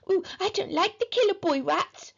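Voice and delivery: put-on high, timid squeamish child; whiny shudder of dislike; shuddering little brother. A narrator's reading. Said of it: "shuddered Peter".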